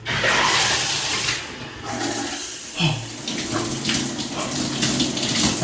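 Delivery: breathless gasp as icy water hits; sharp gasp. Real, not acted. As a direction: suck in air at the cold shock.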